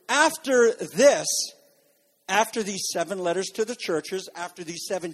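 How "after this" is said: slow, raised, louder proclamation; emphatic scripture reading. A narrator's reading. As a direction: draw out the opening words. Preacher begins reading the verse.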